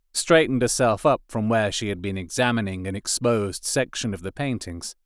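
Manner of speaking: ordinary delivery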